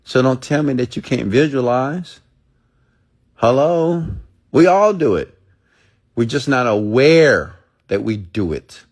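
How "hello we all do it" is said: emphatic hello. Real, not acted. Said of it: "emphatic rhetorical challenge".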